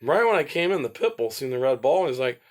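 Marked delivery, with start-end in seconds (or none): none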